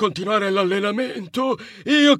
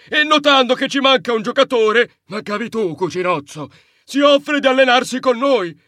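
usual speaking voice

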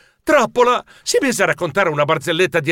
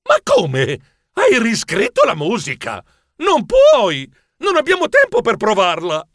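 alarmed, higher and louder at the climax; agitated protest; 6 semitones higher, 3 dB louder than usual. Acted. Director to musician on opening day, panicky objection.

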